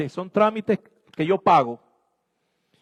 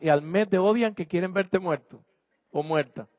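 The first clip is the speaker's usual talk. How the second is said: normal talking voice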